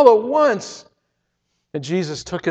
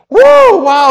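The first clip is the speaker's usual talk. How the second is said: a much higher, louder gasp of wonder; awed exclamation; 12 semitones higher, 11 dB louder than usual. Real, not acted. [name] feeling freedom in his new body, awed exclamation. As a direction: blurt out pure amazement.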